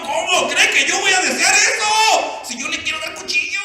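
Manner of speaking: high, loud outburst; indignant protest. Acted. Betrayed husband objecting to the pastor's request, indignant. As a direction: protest in acted outrage, rushing the end.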